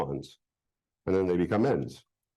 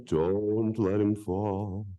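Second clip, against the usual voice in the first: slow warning; 4 semitones lower, much slower than usual. Acted. Instructs second volunteer.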